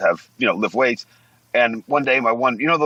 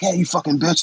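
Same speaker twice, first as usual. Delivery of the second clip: retold yell; 7 semitones higher than usual. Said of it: "They start screaming at her".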